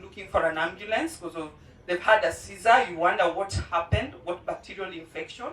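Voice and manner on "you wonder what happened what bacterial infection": normal talking voice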